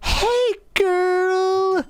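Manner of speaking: very high, sing-out hello; unserious greeting. Acted. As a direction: put on a jokey high-pitched greeting. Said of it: "instead of talking to them seriously".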